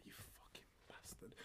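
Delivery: whispered pained curse. Retold or imitated. Dad cursing his son in a whisper after a hit to the groin. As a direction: hiss it under the breath after the hit. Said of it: "I'm like".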